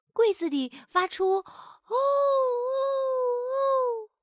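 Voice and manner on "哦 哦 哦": long, high, wavering hoot; echoing "ooh". A narrator's reading. Echo from the wardrobe drifting through an empty room, wailing. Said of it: "这样的回声".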